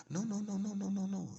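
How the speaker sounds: high, loud string of no's; retold insistent refusal. Lawyer refusing, retold argument.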